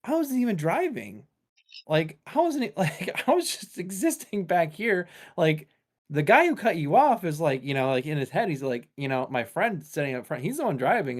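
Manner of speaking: high whiny protest; indignant passenger. Retold or imitated. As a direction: play the baffled passenger pleading he wasn't driving. Innocent passenger voiced in story.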